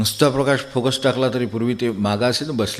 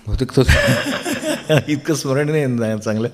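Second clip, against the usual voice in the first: real chuckle breaking into the words, higher; laughing while talking; 4 semitones higher than usual. Real, not acted. Speaker amused at own hazy memory of old film shows, laughing. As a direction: let the laugh lift the voice as you speak.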